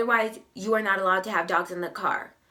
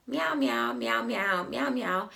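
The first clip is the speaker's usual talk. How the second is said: cat meows; 3 semitones higher than usual. Retold or imitated. Cashier imitating the cat she heard meowing in the store, lightly. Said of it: "I start hearing meowing".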